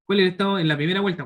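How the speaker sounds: asked fast and high; quick question. Shown as a demonstration. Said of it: "¿Cuál es el estado original?".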